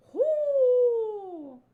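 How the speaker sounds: high, long drawn-out whoo sliding down; amazed whoo. Acted. Crew amazed by the undersea volcano, long high whoo. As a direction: let out a long awed hoot. Crew's amazed reaction, acted.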